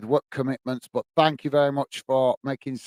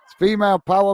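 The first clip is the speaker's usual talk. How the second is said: laughing; 6 semitones higher, 6 dB louder, faster than usual. Real, not acted. Joking about wives.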